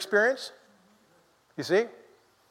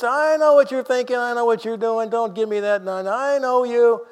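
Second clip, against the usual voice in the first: higher, knowing, accusing voice that sees through you; accusing voice of the law; 5 semitones higher than usual. Acted. Preacher voicing the law to the hidden old sinner, accusing and knowing. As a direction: play the law calling out the sinner's faking. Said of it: "See, that's the voice that speaks to the old sinner".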